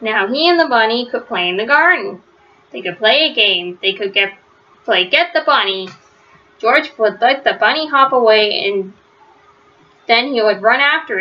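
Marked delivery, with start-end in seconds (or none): none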